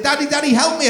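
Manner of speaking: put-on high, loud child's cry for help; child pleading. Son stuck behind sofa calling Daddy, high plea. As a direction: plead like a stuck small boy. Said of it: "he said".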